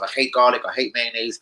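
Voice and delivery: ordinary delivery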